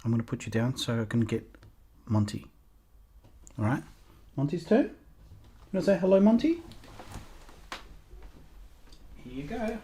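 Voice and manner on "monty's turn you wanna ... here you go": soft high coo to a puppy; puppy talk. Real, not acted. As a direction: coo at the puppies like babies.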